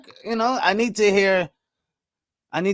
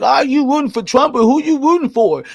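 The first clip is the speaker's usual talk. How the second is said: higher, louder mocking voice of a critic; 9 semitones higher, 5 dB louder than usual. Mocking an imagined critic who accuses him, raised needling voice. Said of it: "don't come at me and be like".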